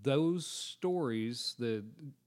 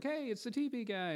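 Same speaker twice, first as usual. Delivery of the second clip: much higher, bright mock fan greeting; fans calling out; 12 semitones higher than usual. Theater fans spotting the TV guy, mock excited. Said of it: "people who were like".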